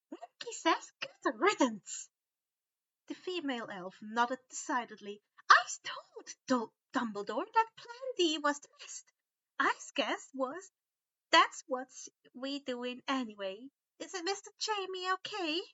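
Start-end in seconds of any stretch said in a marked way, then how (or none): 0.1-2.1 high, curt elf voice; female house elf. A narrator's reading. Winky dismisses Umbridge's death, high elf voice. 5.5-15.6 high, chattering elf; house elf voice. A narrator's reading. Winky explains to Harry, high chattering elf.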